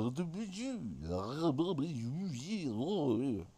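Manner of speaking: singsong French gibberish; mock French. Sampling the sounds of French language, mock French gibberish. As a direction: babble fake French in rising and falling waves. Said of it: "In French language".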